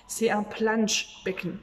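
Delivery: "das Schwimmbecken" drawn out slowly and clearly; teacher modelling a German word slowly; slow vocabulary demo. Shown as a demonstration. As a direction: present the new word slowly to the class. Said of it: "Je vais t'apprendre du vocabulaire".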